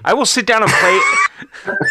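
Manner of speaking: high laughing yell; laughing shout. Real, not acted. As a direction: burst out laughing while shouting. Laughing shout mid-story.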